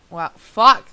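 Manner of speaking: usual speaking voice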